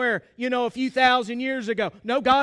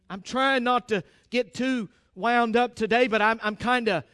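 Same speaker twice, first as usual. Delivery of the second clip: normal talking voice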